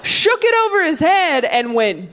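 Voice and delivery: ordinary delivery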